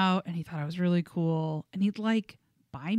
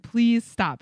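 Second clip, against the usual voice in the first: louder, firmer "Please stop"; insistent, louder "Please stop"; 3 semitones higher, 7 dB louder than usual. Retold or imitated. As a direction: say it with more force. Repeated plea, louder.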